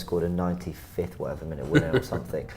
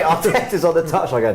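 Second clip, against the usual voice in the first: ordinary talk, nothing marked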